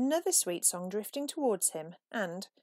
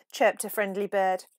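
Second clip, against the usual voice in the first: ordinary delivery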